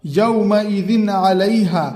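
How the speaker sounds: very slow, clear tanween before ऐन; slow clear reading of यौमइदिन अलैहा; izhar recitation. Shown as a demonstration. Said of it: "इजहार होगा".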